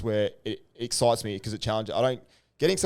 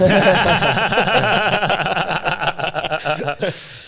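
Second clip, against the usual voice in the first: loud laugh; 9 semitones higher, 9 dB louder than usual. Real, not acted. Laughing at the joke.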